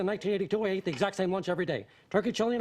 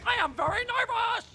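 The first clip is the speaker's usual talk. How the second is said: anxious declaration; 14 semitones higher than usual. Acted. Boss declaring his nervousness.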